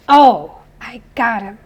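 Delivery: sudden loud "Oh", then settling; mock triumph. Teacher acting out swatting a mosquito, mock triumphant burst. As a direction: play-act catching a mosquito.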